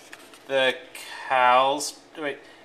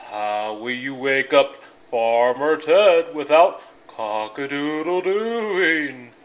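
loud, slow cow voice; 2 semitones lower, 7 dB louder than usual. A narrator's reading. Cows asking rooster, slow cow voice. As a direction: drag it out like a cow.

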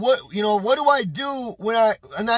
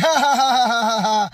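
imitated laugh; 4 dB louder than usual. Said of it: "And she was laughing".

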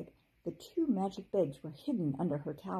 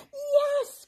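high, loud fairy cry; high loud cry of relief; 9 dB louder than usual. A narrator's reading. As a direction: call out from inside the shell. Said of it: "cried a voice".

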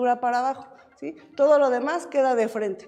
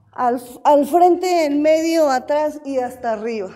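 barking gym coach; 2 semitones higher, 8 dB louder than usual. Real, not acted. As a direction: shout the command across the gym. Instructor calls arm drill to class.

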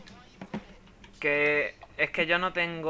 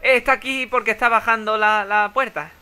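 excited; 5 semitones higher, 6 dB louder than usual. Real, not acted. Spotting the enemy mid-fight.

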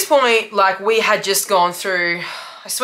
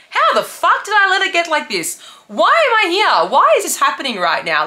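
pitched way up, rising in disbelief; disbelieving panic; 10 semitones higher, 4 dB louder than usual. Retold or imitated. Sitting in the gutter, asking herself in disbelief. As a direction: ask it at the sky in shock, voice climbing. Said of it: "And I was like".

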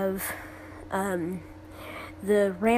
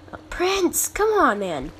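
exasperated plea; 7 semitones higher than usual. Real, not acted. Pleading with the pet dragon.

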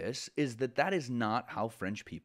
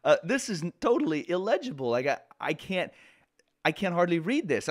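higher, louder mock exasperation at messy code; exasperated teacher; 6 semitones higher, 4 dB louder than usual. Teacher retelling his reaction to a student's messy code, mock-exasperated and loud. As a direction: throw up your hands at unreadable code, half joking. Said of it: "I'm looking at this thinking".

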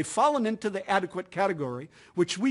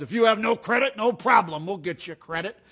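hulking TV car salesman, pumped up; 3 semitones higher, 4 dB louder than usual. Retold or imitated. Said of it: "And then he goes".

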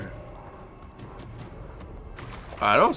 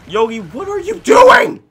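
loud, much higher yell at the bear; frantic shout; 10 dB louder than usual. Real, not acted. Gamer yelling at his bear for wandering off, frantic shout. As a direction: yell at your wandering companion.

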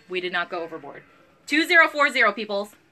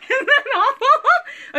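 high, slow laughing cry; laughing squeal; 6 dB louder, slower than usual. Real, not acted. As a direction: laugh it out in mock horror. Laughing at her own neglect.

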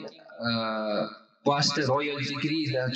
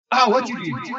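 higher, louder startled cry of pain; pained yelp; 6 semitones higher, 5 dB louder than usual. Retold or imitated. Customer yelps at the tattoo artist in pain. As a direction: yelp as the needle bites. Customer cries out under needle.

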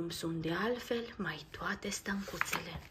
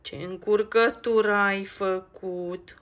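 slow, louder mild scolding; 8 dB louder, slower than usual. Acted. Nico scolds Max for the mix-up, a mild slow scold. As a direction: tut at the mess he made. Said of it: "îl dojenește Nico".

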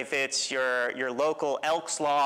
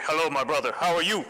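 deep phone voice; 3 dB louder, faster than usual. Retold or imitated. Friend imitating his deep voice answering a call from Mali. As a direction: voice dropped low and booming for the call. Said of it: "That is not your voice, dude".

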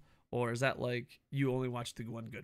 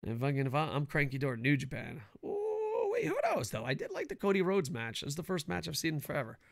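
normal talking voice

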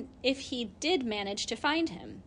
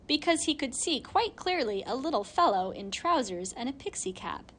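plain reading voice

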